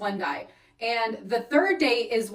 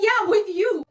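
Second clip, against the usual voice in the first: high, loud, incredulous exclamation; retold indignant correction; 9 semitones higher, 6 dB louder, slower than usual. Woman correcting her confused date, loud retold indignation. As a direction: raise pitch and volume to correct him.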